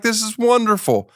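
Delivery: high, sing-song fake enthusiasm; mock delight. Comic reacting to yoga invite, high mock delight. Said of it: "And I'm like".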